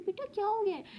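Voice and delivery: a mother's startled what's wrong; startled mother asking her daughter what's wrong; surprised mother. Acted.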